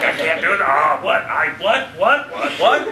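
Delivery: a robot glitching in confusion; confused robot voice. Retold or imitated. Actor arguing with himself through robot voice delay, baffled and loud. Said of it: "arguing with himself in a robot voice".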